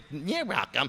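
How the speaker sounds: mimicking a fake-polite sing-song; mock sing-songy. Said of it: "your sing-songy voice".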